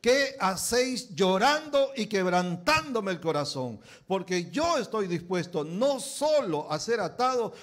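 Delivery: loud, slow, high proclamation of Paul's resolve; Paul's defiant shout. Acted. Preacher to congregation, voicing Paul, full-force shout. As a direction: cry out Paul's words as if ready to die. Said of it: "Entonces Pablo respondió".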